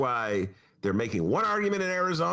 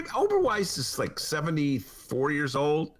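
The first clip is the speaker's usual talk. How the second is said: usual speaking voice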